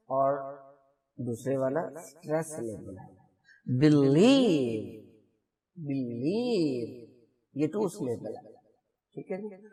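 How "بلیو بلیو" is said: Blue very slow, second syllable stressed; slow stress demo. Shown as a demonstration. Teacher rereading for clarity, blue very slow and stressed. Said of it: "اب میں اس کو دوبارہ سے پڑھتا ہوں".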